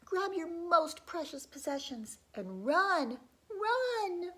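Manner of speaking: high, loud panicked shout; yelled warning. Acted. Villagers yelling at the townsfolk to flee, panicked shout. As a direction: yell at everyone to flee. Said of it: "Men yelled".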